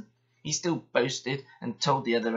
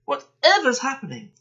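startled trucks; 11 semitones higher, 6 dB louder than usual. Acted. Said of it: "they cried".

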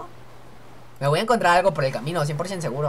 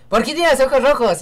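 higher, louder mock mother asking; mother's voice; 6 semitones higher, 4 dB louder than usual. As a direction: play the worried mum in the joke. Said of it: "y le dice a su mamá".

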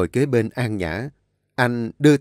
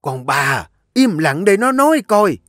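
sharp high louder command; stern grandmother; 8 semitones higher, 6 dB louder than usual. A narrator's reading. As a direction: cut her off hard. Grandmother silences Ba Ba.

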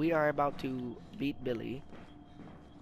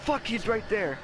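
high startled yelp; panicked; 9 semitones higher, 6 dB louder, slower than usual. Real, not acted. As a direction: blurt it in fright. Chased by monster in game.

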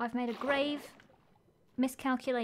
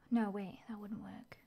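unvoiced, hushed whisper; 4 semitones lower, 8 dB quieter than usual. Real, not acted. Rethinking her plan to herself, whispered. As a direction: mutter the doubt under your breath.

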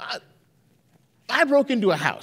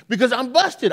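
usual speaking voice

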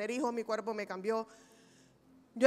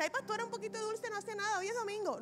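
much higher, softer wheedling voice; sweet-talking tempter; 9 semitones higher, 6 dB quieter than usual. Retold or imitated. Someone tempting the pastor with sweets, high wheedling. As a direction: coax the pastor like a sly friend offering candy. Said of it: "ven y me dice".

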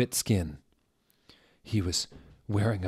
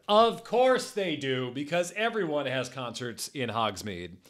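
sarcastic aside; 5 semitones higher than usual. Real, not acted. Reader mocking the concerts in Hogsmeade, sarcastic. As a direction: stretch out "of course" in high-pitched mockery.

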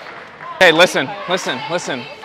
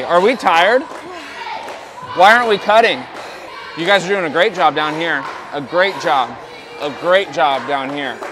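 ordinary talk

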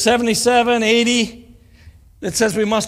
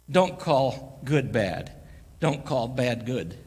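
slow and deliberate; 6 semitones lower, 3 dB quieter, much slower than usual. Acted. Slow emphatic instruction.

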